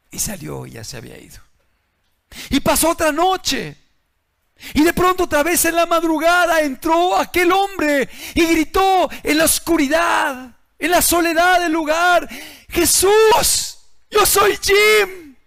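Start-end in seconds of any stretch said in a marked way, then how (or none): 2.5-12.3 louder, higher preacher's cry; full-force sermon voice; fiery preaching. Acted. 12.7-15.4 high, loud slow cry to Jesus; loud drawn-out calling out. Acted. Gritó en la oscuridad.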